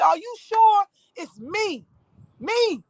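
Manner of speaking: high, drawn-out disbelieving question; stunned retold protest; incredulous. Retelling disbelief at arrest.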